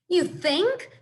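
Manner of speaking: high, loud alarmed squawk; young goose squawking in disbelief. A narrator's reading. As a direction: shoot it out like a startled bird. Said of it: "squawked Bright Bill".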